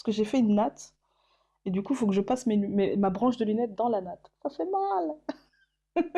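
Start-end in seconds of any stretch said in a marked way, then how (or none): 4.4-6.0 high, long-drawn squeal of pain; playful pained whine. Pretend. Vlogger moaning about glasses caught in her braid, playful pained whine.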